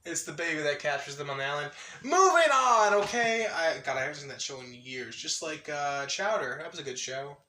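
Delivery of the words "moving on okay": loud, high, drawn-out call; emphatic topic change. Real, not acted. Cutting off their own Flapjack tangent, loud singsong. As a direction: belt it out to cut the bit short. Breaks off the voice bit.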